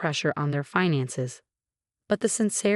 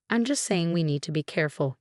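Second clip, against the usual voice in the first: ordinary delivery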